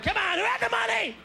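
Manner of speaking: high, shouted demand for answers; mock demanding yell. America hounding those who admitted mistakes, high mock shout. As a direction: bark questions like a hounding reporter.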